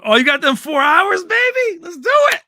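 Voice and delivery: high, loud hammed-up glee; mock excited. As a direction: squeal it like a delighted host. Mock excited reply to guest.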